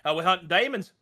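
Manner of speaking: hammed-up Australian; mock Aussie. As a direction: play a cheerful Aussie demon hunter. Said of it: "just be like".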